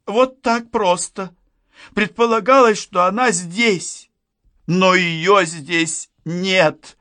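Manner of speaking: high, slow, desperate lament; distraught. A narrator's reading. Distraught friend confesses she lost her. (высоким голосом, в отчаянии)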